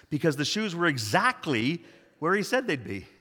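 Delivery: ordinary talk, nothing marked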